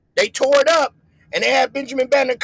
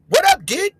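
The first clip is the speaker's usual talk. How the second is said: high, louder "What up, did?" with "did" for dude; 10 semitones higher, 4 dB louder than usual. Retold or imitated. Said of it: "When you know how to say did like that".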